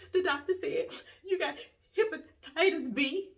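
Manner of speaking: high-pitched fake sobbing, words broken up; pretend crying breakdown. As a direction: choke out the news in mock tears. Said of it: "acting like I am having a mental breakdown".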